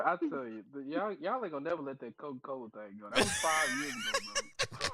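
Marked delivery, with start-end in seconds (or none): 3.1-4.9 laughing. Real, not acted. Driver laughs off the teasing.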